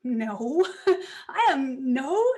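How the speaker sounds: loud, giggly protest; laughing denial. Retold or imitated. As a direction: brush off the praise through a laugh. Denying husband's praise.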